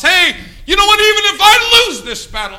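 high loud rousing cry. Real, not acted. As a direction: rise up and rally them. Preacher building climax.

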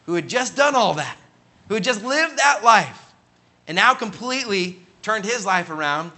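high, loud preaching emphasis; emphatic amazement. Real, not acted. Preacher marvels at who met whom, emphatic. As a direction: drive the coincidence home with rising force. Preacher stresses the striking coincidence.

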